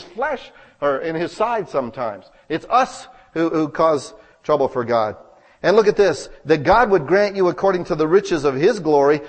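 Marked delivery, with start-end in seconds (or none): 5.6-9.2 preached louder, rising with fervour; full-force preaching. Acted. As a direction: proclaim it to the congregation.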